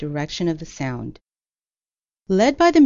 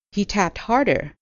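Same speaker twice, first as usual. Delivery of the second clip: plain reading voice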